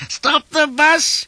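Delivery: very high, slow loud wail; piercing falsetto plea; choking goat-like cry. A narrator's reading. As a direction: bleat it out, stretched and strangled. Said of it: "he cried".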